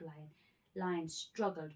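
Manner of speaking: plain reading voice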